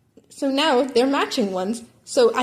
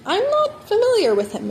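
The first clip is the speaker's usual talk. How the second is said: brash auntie; 11 semitones higher, 4 dB louder than usual. A narrator's reading. Mitsuki voice.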